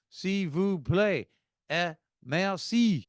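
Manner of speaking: deliberate phrasebook demonstration; demonstrating French politeness. Shown as a demonstration. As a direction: spell out "s'il vous plaît" and "merci" slowly, with emphasis. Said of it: "And I say".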